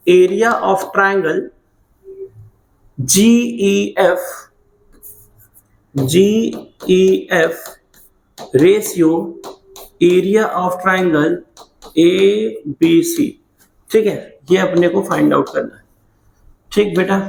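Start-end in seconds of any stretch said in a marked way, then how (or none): 0.1-9.1 slow dictation. Shown as a demonstration. Dictating what to find. 10.0-13.3 slow dictation. Shown as a demonstration. Dictating what to find.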